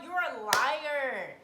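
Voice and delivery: loud, high, stretched-out outrage; indignant accusation. Retold or imitated. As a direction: call out a liar at full volume, dragging the words. Retells calling the boyfriend a liar.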